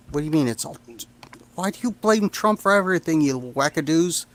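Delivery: ordinary talk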